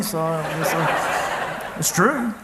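chuckling through the words, slowing down; a real soft laugh trailing away. Real, not acted. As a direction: laugh it off softly. Laughing after telling funny story.

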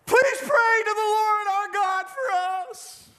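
high, slow, crying plea for help; a frightened king pleading with the prophet; desperate plea. Acted. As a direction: beg like a terrified king. Zedekiah begs Jeremiah in panic.

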